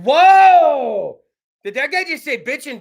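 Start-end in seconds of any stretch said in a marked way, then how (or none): 0.0-1.2 loud startled gasp of a churchgoer; hammed-up surprise; mock shock. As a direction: react like someone scandalized.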